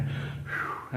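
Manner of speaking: unvoiced exhale of relief; mock relief breath; breathy phew of relief. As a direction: blow out a soft relieved phew. Student relieved he deceived mother.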